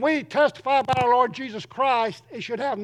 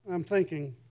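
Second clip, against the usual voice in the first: ordinary talk, nothing marked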